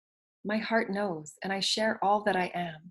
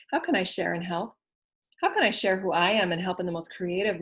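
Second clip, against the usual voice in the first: ordinary talk, nothing marked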